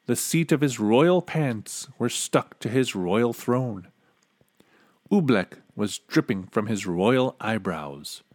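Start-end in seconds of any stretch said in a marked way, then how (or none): none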